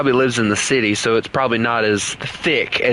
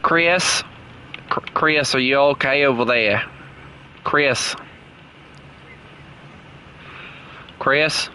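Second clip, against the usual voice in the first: put-on Aussie accent, pestering a friend; Australian accent; 4 dB quieter than usual. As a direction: nag playfully in mock Australian. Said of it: "keep on talking in this, uh, this accent".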